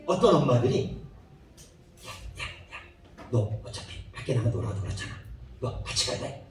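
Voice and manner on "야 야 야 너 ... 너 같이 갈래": put-on whispered, much softer coaxing of the child; mother whispering. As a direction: lean in and whisper to your kid. 엄마가 아이를 조용히 부름. (아이에게 속삭이는 엄마)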